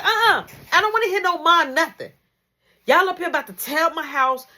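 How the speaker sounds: high, loud mom scolding. Retold or imitated. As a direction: cut him off sharply.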